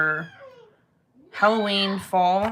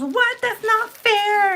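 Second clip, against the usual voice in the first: put-on high, drawn-out child's protest; whining kid imitation; 14 semitones higher, 4 dB louder, slower than usual. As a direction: mimic an indignant little boy's whine.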